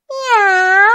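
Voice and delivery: high meow; cat meow. A narrator's reading. The cat meowing as it lands on the wall. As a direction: mew it like a cat.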